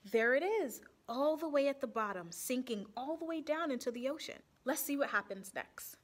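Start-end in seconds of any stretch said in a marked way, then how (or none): none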